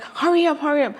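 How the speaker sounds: high, hurried mock nurse; impatient nurse. Impatient nurse urging Diana, high and hurried. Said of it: "She's like".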